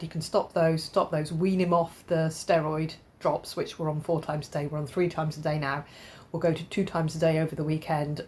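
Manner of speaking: usual speaking voice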